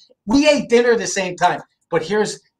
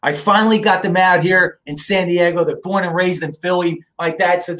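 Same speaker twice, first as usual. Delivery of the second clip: ordinary talk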